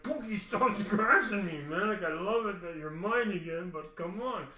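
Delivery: loud, high whining protest; exasperated pleading. Retold or imitated. Owner climbing down with a clawing cat, exasperated pleading. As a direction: plead loudly, half laughing, then ease off.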